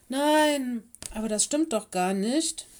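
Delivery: loud, high opening objection; protesting pupil. A narrator's reading. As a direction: contradict the teacher in frustration.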